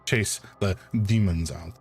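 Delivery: mild put-on French accent with a German tinge; lightly accented doctor, warning gravely; hint of a French-German accent, earnest caution; French with touches of German. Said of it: "French with touches of German".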